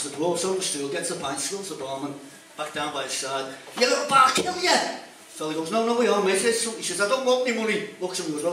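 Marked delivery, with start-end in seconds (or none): 3.8-4.8 loud, high enraged yell; furious shout. Acted. Barman attacked again, threatening. 5.7-7.9 flustered pleading. Acted. Fella placating angry barman.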